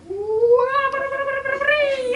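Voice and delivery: high, loud nonsense babble; teasing singsong. Retold or imitated. Gina babbling at the bear trap, mocking it. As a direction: taunt the trap playfully.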